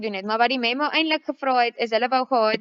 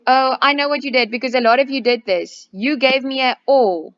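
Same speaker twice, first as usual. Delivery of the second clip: louder, emphatic; 3 semitones higher, 7 dB louder than usual. Real, not acted. Teacher calls out students' common mistake.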